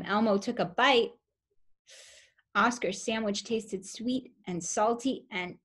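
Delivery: ordinary delivery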